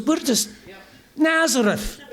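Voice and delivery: light Scottish brogue, laughing on the name; Scottish accent. Retold or imitated. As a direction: finish the joke in a hint of Scottish accent. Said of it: "really thick Scottish accents".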